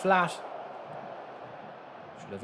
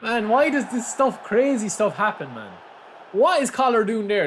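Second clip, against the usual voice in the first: excited disbelief; 9 semitones higher, 5 dB louder than usual. Real, not acted. Disbelief at crazy play.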